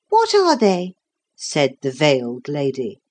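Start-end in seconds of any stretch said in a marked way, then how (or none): 0.1-0.9 high, thin demand; thin, disagreeable voice. A narrator's reading. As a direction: ask sharply in a high, disagreeable voice. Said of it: "a thin and disagreeable voice".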